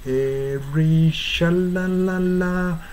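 slow "シャラララ" with clear English l; English l demo. Shown as a demonstration. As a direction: hold each "la" on the tongue tip, slowly. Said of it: "日本語式のシャラララとは全然違う". (ゆっくり L の発音で「シャラララ」)

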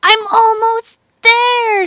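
high, slow, straining hope; almost at the top, reach for it; eager. A narrator's reading. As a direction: Jill nearly out of the hole, high and eager. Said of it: "she thought".

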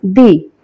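দি, low, with an ee vowel. Shown as a demonstration. Teacher answering how "the" sounds before vowels, a low দি with ee. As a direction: answer low with দি, rhyming with "bee". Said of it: "ভাওয়েলটার উচ্চারণ কি হবে তখন".

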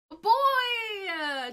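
loud, high, long-held exclamation; excited shout. Real, not acted. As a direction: shout the big reveal. Gender of the new baby revealed.

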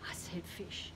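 soft whisper; whispered aside. Acted. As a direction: slip it in under your breath. Aside after counting.

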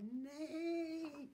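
high, loud, drawn-out neigh; storybook horse call. A narrator's reading. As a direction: stretch the word into a whinny. Narrator imitates a horse's neigh.

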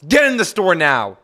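loud robber's command; barked order. Acted. Robber to gas station customer, barking an order. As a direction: order the hostage inside, loudly.

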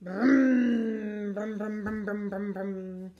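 a toy bus driving off into the distance; bus engine noise. Retold or imitated.

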